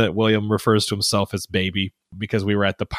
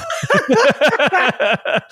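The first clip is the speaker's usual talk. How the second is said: high real laughter; cracking up; laughing at his son's words; 3 dB louder than usual. Real, not acted.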